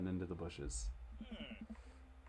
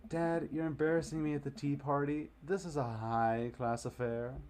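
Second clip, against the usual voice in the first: embarrassed daughter; 6 semitones higher than usual. Acted. Daughter scolding dad at party.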